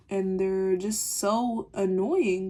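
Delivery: ordinary delivery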